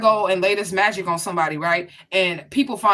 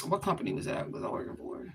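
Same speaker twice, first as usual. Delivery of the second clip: soft, half-whispered aside to herself; muttering to herself; 11 dB quieter than usual. Real, not acted. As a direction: drop to a near whisper while trying to remember. Asking herself, trying to recall.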